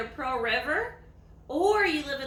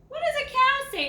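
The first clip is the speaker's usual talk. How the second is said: much higher, louder quiz question; excited question; 12 semitones higher, 6 dB louder than usual. Acted. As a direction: ask it like an eager teacher to little kids. Quizzing children on animal sounds.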